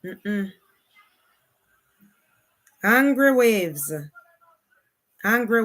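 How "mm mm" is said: ordinary talk, nothing marked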